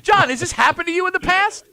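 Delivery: high, loud disbelief; outraged, shocked questioning; incredulous. Real, not acted. Host incredulous at caller.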